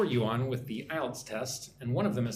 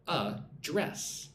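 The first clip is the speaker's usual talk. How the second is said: "address" broken in two, uh then DRESS; verb stress, split; 2 semitones higher than usual. Shown as a demonstration. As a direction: say "address" slowly as two syllables, second one stressed. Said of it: "notice how when it's a verb".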